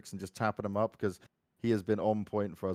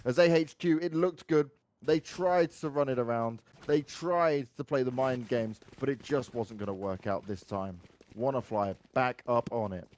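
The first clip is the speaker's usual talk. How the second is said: excited play-by-play, higher and louder; esports caster; 7 semitones higher, 6 dB louder than usual. Acted. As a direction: build to the clutch call. Live round play-by-play.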